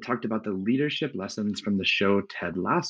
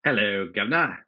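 hammed-up, unconvincing British greeting; failed British accent. Co-host asks host about the accents, bad British accent. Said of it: "you're not talking in a British accent".

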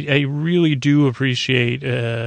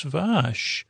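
tender; 3 semitones higher, 5 dB quieter than usual. Retold or imitated. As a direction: say her name like a fond surprise. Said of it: "He f-, says it very tenderly".

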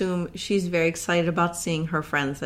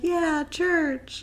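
mock enthusiasm; 9 semitones higher than usual. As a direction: imitate an eager little crowd. Voicing townsfolk's reaction to church news.

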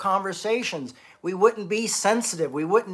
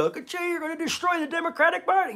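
high, whiny imitated accusation; mock upset partisan; 12 semitones higher, faster than usual. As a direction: parody an angry critic. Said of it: "So if you get upset at me and go".

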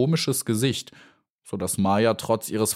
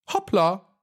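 Hoppla; 11 semitones higher than usual. Acted. Grasshopper leaps away.

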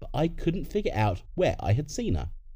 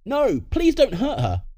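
high, loud, drawn-out plea; crying out; 12 semitones higher, 5 dB louder, slower than usual. Acted. Girl pleading with masked intruders, crying out. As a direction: cry out to protect someone. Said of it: "she cried".

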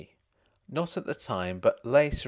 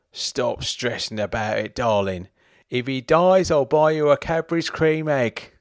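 higher, louder tipsy greeting; drunk voice; 3 semitones higher, 7 dB louder than usual. Acted. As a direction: slur it sweetly like a drunk husband. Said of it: "still in his drunk voice".

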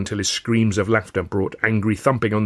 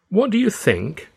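high, slow teasing question; playful musing; 11 semitones higher, slower than usual. A narrator's reading. Father with boy on his knee, playful high musing. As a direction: muse aloud, drawing it out high. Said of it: "I would muse".

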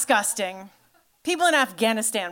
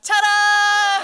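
much higher and louder, a showy reveal; triumphant ta-da; 9 semitones higher, 5 dB louder than usual. Retold or imitated. Sitting up on the bed to show her husband, triumphant. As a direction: present yourself like a magic trick. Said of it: "I sit up on the bed like".

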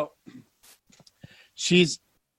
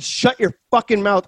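retold insult; 6 semitones higher than usual. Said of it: "but I said".

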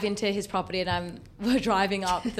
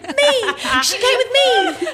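high, loud slow shout; mum shouting; 11 dB louder, slower than usual. Retold or imitated. Mum calling out to Caesar, high loud shout. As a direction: yell it out eagerly.

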